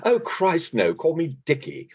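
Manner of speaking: high-pitched, theatrical quoted reply; Richard Attenborough. Retold or imitated. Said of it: "And he goes".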